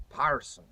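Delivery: "parson" with open AR vowel and sounded R; Paul Revere pronunciation. Shown as a demonstration. Historian showing Revere matched Shakespeare, "parson" with open AR. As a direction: say "parson" for person, colonial style. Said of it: "Paul Revere would have said".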